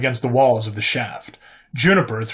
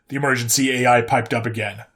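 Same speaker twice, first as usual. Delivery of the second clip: ordinary delivery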